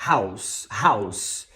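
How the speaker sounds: "House" low, ending in hissed voiceless s; final S sound. Shown as a demonstration. Teacher answering own quiz, "House" with final s. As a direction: end "House" on a clean s. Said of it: "de um S para um Z".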